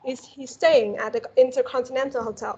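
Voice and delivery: ordinary talk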